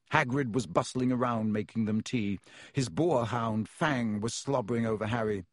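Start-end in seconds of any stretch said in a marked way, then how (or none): none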